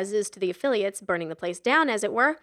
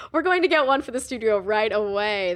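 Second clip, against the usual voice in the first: radio DJ; 7 dB louder than usual. A narrator's reading. Morning Zoo DJ on radio.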